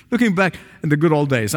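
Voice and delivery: ordinary delivery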